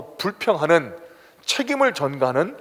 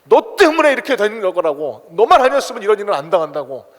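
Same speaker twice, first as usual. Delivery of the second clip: louder, higher accusing voice; blaming outburst; 6 semitones higher, 6 dB louder than usual. Retold or imitated. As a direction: jab the blame at the other person. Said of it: "또 남을 원망하게 합니다".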